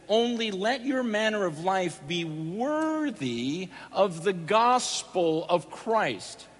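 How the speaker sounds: slow, solemn scripture reading. Shown as a demonstration. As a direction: read the verse slowly, weighing each word. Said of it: "it says".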